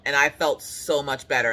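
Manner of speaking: usual speaking voice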